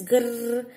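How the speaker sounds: rolled machine-like "grr"; imitated whirring. Shown as a demonstration. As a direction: imitate a motor whirring into life with a trilled r. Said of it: "એવો અવાજ". (મશીનના ઘરઘરાટની નકલ)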